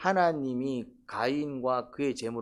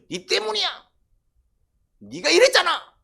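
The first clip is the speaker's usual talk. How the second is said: high, loud accusatory yell; blaming shout; 10 dB louder than usual. Acted. Cain blaming someone else for his problem, angry accusing shout. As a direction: pin the blame on them, yelling. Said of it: "그럼 가인은 어떻게 해석했다고요".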